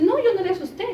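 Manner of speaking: imitating the friend's frightened denial; alarmed denial. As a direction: deny it in a rush of alarm. Friends accusing each other in fright.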